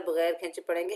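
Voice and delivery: ordinary delivery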